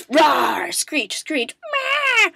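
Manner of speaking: mock monster rawr and screech; hammed-up creature screech; Ridley roar. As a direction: play a space dragon roaring. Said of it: "come on down and be like".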